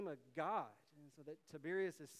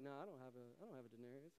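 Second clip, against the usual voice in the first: sheepish denial; 5 semitones lower, 11 dB quieter, much faster than usual. Retold or imitated. Crowd hastily denies having coin.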